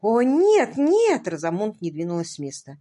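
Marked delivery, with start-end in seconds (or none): 0.0-1.3 higher, louder desperate cry of no; terrified horror victim. A narrator's reading. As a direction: cry out in dismay. Розамунд в отчаянии восклицает. (в отчаянии, громко)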